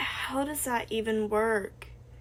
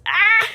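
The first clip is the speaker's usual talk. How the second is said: sudden high shriek of fright; loud, high scream cut short; startled scream; 9 semitones higher, 9 dB louder than usual. Acted. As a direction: yelp as something rushes at you. Screams as something gets close.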